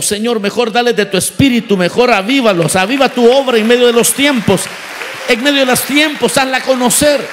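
loud, pleading revival prayer; fervent preaching. Acted. Preacher pleading loudly with God for revival.

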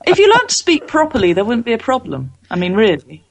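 American faking English, starting high and falling; bad English accent. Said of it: "doing an impression of an American, doing a bad impression of an English person".

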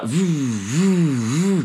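rising and falling car noise; imitated engine revving. Storyteller mimics the Mercedes trying to keep up, imitated revving. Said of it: "he's like".

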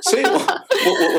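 stammering through laughter; laughing. Real, not acted. Officer recalling calling his commander an idiot, laughing. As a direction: laugh while getting the words out.

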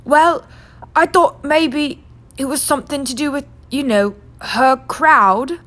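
uneasy husband, slowing at the end; nervous fumbling. Acted. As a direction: edge carefully toward the taboo.

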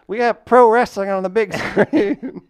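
high, loud, slow mock old-timer; super old-timer. Host mocking his old-fashioned phrase, loud old-timer voice. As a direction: play up an old-timey boast, laughing near the end. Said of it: "make me sound super old".

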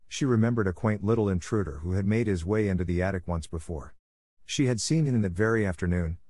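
plain reading voice

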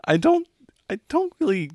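high-pitched, halting let-down; high, hesitant woman saying no; awkward rejection. Acted. GM voices Jess turning player down.

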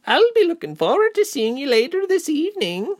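very high-pitched cheerful woman; bubbly hostess in a high voice; cheerful chatter. A narrator's reading. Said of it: "the woman chattered on cheerfully".

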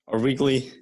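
talking while chewing gum. Real, not acted. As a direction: speak around a wad of gum. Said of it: "Sorry, I have gum in my mouth".

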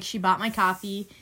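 normal talking voice

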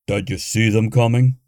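huffed. A narrator's reading. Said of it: "Bernie huffed".

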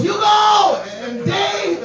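high shouted name, acted out; calling out. Sal spots Hugo fighting in the street, calling out. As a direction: imitate a friend hollering across the street.

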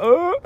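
high-pitched mock squeal of alarm; startled squeal. Podcaster recalls mistaking rubbish for a dead pigeon, startled squeal. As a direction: re-enact a startled, rising yelp.

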